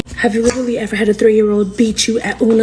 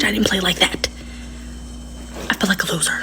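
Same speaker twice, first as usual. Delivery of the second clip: hushed whisper; whispered aside; 3 semitones lower, 7 dB quieter than usual. Real, not acted. Aside on her own childhood.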